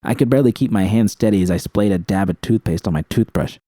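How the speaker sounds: ordinary delivery, nothing marked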